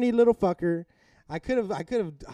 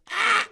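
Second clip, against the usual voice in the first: mock scream; 5 dB louder than usual. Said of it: "bucket hat probably went like this".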